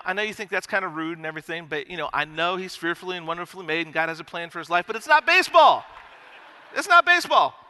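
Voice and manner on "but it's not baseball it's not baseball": higher and louder, hammering it's not baseball; emphatic shout. Real, not acted. Pastor shouting the punchline to the congregation, loud and high. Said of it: "punchline shouted for emphasis".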